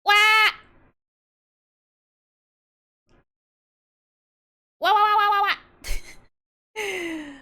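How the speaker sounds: high, loud fake wails; hammed-up fake crying wah wah; mock wailing. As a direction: wail like a cartoon baby.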